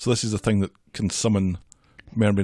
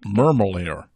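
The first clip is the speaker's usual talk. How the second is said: American accent; 3 dB louder, slower than usual. Shown as a demonstration. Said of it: "your American accent".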